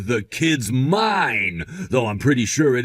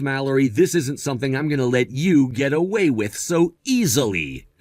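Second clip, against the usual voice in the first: ordinary delivery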